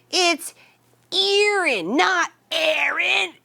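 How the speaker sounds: imitating a peeved coworker correcting the name; irritated correction. Coworker snapping at cashier over the name, mock annoyed. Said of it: "she was angry".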